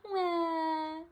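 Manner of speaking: put-on high toddler wah; a play-acted baby cry; storybook sob; mock crying wail. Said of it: "cries George".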